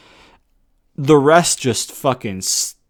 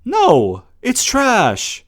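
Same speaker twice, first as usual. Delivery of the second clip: indignant rejection; 9 semitones higher, much slower than usual. Real, not acted. Rejecting the booking decision outright.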